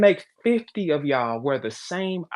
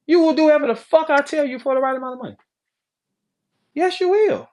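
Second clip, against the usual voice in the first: insistent; 10 semitones higher, 5 dB louder than usual. Real, not acted. Insisting to women listeners.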